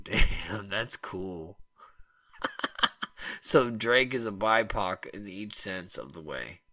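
usual speaking voice

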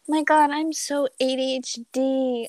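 high, mocking valley girl voice; basic middle school girl. Mocking girls who claim ADHD, in a high ditzy voice. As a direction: ham up a shallow schoolgirl. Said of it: "They're like".

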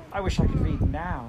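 longing to start right away; eager exclamation. Acted. Heidi tells the grandmother she longs to read, mildly eager. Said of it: "sighed and exclaimed".

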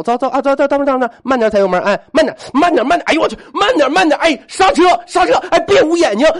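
high, loud frantic shouting, building; hammed-up panicked driving instructor, pitch far up; mounting panic. Husband teaching his wife to drive as she loses control, rising panic.

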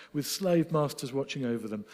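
ordinary talk, nothing marked

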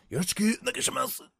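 very high, fast anime-style Japanese; Japanese phrase. Pretend. Vlogger greeting viewers in Japanese, high and fast. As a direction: chirp it high and quick like an anime girl. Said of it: "please take care of me in, uh, Japanese".

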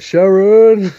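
hammed-up [name] calling his wife; imitating [name]'s yell. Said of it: "every episode of that was just like".

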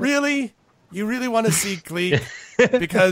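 high, loud goblin disbelief; dismissive high-pitched goblin; incredulous goblin. Acted. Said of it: "one of them says".

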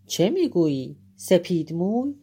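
loud, startled outcry; shouting. A narrator's reading. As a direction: cry it out in disbelief. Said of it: "ناگهان سام از جا برخاست و فریاد زنان گفت".

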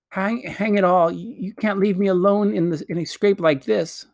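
put-on high-pitched boyish protest; indignant pleading. A narrator's reading. Digory begging Polly not to leave him, indignant. Boy protests at being left alone.

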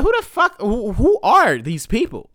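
sudden high-pitched who, then slow; baffled, drawing out the question; high confused disbelief. Retold or imitated. Confused reaction to the message.

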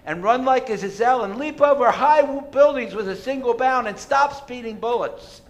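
mock heroic. Mocking the demand to be Superman.

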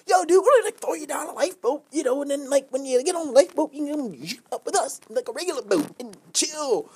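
high-pitched yacht partier; calling down. Acted. Partier offers a stranded man a lifeboat, calling down high. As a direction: pitch it high, selling the rescue from above.

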